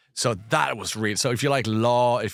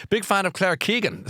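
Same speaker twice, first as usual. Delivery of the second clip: a lightly voiced eager fan, higher; mock keen fan; 10 semitones higher than usual. Reading Shirley's message aloud, lightly voiced as an eager fan. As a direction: gush it like a keen reader. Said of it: "says Shirley".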